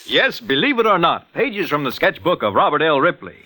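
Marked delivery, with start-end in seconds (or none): none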